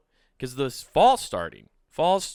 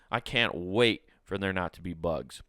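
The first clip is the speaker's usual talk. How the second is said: ordinary talk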